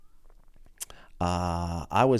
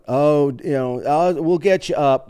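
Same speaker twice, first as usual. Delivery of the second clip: higher, breezy host voice, brushing you off; mimicking a casual host; 5 semitones higher, 3 dB louder than usual. Imitating a lazy open mic host, higher voice. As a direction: play the lax host waving you off.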